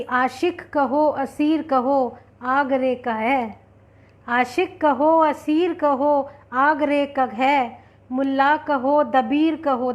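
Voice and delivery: slow, lilting verse recital; poem recitation. Acted. Host quoting the poet to her audience, slowly recited. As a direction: recite it slowly, stretching the refrain.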